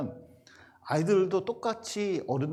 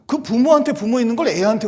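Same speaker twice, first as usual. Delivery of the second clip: much higher, much louder indignant cry; indignant outburst; 9 semitones higher, 13 dB louder than usual. Real, not acted. Pastor recalling a deacon insulting a child, indignant outburst. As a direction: let the outrage burst out.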